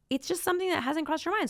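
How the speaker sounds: usual speaking voice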